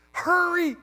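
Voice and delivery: high, loud snap of impatience, lightly played; a retold burst of late-night impatience; mock impatient yell. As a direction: urge him on, half-shouting in exasperation. Impatient late-night phone call.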